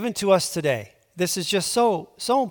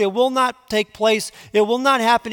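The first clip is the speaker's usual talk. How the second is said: "it will not happen" raised high and louder, pressed hard; fervent preaching; 5 semitones higher, 3 dB louder than usual. Acted. Preacher voicing God's warning to Ahaz, urgent preaching. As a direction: pound out "it will not take place, it will not happen".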